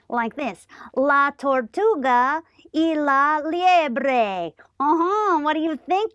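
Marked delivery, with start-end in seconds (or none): none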